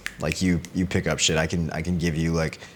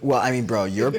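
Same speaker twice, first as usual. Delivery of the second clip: ordinary delivery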